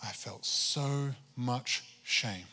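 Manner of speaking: slow whisper, so drawn out. Real, not acted. Pastor confessing his shame to the congregation, slow whisper. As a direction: breathe it out slowly in a whisper.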